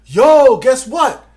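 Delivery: high, loud, hyped-up call of "Yo"; excited news. Retold or imitated. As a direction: burst in with big news. Friend bursting in with news.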